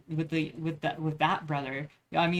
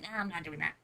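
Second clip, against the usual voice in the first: much softer, dismissive mock voice; rude brush-off; 7 dB quieter than usual. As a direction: toss it off like you can't be bothered. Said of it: "he even said it in a rude way".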